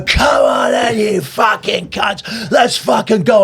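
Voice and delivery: high, strained mock war cry; retold battle yell. Storyteller acting out his charge at bikers, yelling high. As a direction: holler a hammed-up challenge to a fight.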